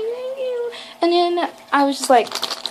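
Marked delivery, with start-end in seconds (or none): 0.0-0.7 very high, soft little squeak; small nervous voice. Retold or imitated. Star-struck fan thanks singer, in a tiny high voice. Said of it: "I talk in such a small voice".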